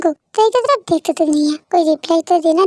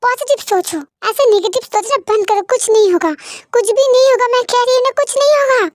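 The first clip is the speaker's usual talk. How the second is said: higher, insistent wife reassuring her husband; pleading reassurance; 8 semitones higher than usual. Acted. Wife insists to her husband that all will be fine, pleading. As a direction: keep pressing that nothing bad will happen.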